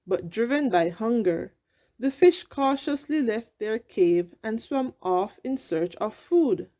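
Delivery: ordinary delivery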